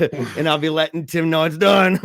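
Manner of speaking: giggling while finishing the line; laughing. Real, not acted. Laughing while telling the story.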